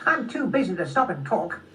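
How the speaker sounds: high-pitched, impatient squirrel voice; busy squirrel. Acted. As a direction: play a squirrel too busy to chat.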